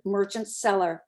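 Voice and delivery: ordinary delivery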